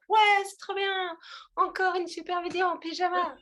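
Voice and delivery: high, loud, bubbly cheerleader gush. Pretend. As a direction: cheer it way up like a pep squad. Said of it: "les cheerleaders en mode".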